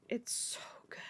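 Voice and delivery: hushed, whispered gushing; barely-there whisper; awed whisper. Real, not acted. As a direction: whisper it, overcome by how good it is. Overwhelmed by the book scene.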